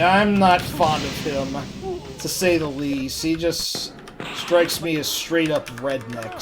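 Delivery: ordinary delivery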